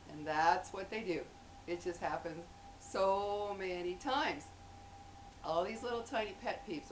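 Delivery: normal talking voice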